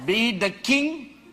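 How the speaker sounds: loud, high, laughing fire-and-brimstone preaching; preaching at full force. Acted. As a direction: shout it out to the congregation, laughing with zeal.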